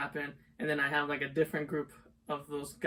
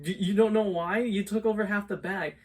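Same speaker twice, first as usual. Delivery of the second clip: loud, raised mock outrage; retold indignation; 5 semitones higher, 7 dB louder than usual. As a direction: act out telling her off, louder and higher. Retold quarrel over snacks.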